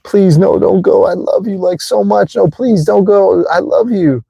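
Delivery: put-on high, urgent begging as the old self; desperate pleading. Speaker's old self begging a partner not to leave, desperate pleading. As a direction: play a clingy lover begging someone to stay. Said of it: "the old me would say".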